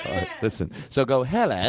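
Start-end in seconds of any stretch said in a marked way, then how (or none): 1.2-1.7 short Cockney hello. Shown as a demonstration. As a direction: model the Cockney greeting. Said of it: "do the Cockney first".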